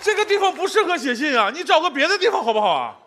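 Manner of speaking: ordinary talk, nothing marked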